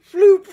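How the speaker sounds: high, loud sound effect; mimicked "floop". Viewer imitating the move on screen, high "floop". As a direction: squeal a cartoon flop noise. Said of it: "He's like".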